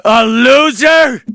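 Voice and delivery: lightly voiced Biden rising to a shout; mock worked-up Biden. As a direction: ham up an old politician getting heated. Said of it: "gets all excited".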